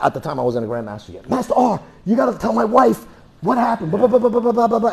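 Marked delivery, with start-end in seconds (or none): 1.3-4.9 high, pleading mock panic; panicked student. Student on the phone begging the master to explain, high mock panic. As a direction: imitate the frantic student begging for help.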